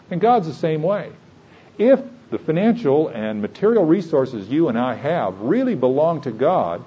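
ordinary talk

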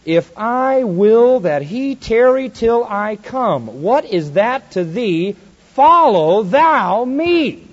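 slow, loud, heavy emphasis; commanding sermon emphasis, slow and loud; emphatic. Acted. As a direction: lean hard on every word of Follow thou me. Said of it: "this is emphatic as you can ever get".

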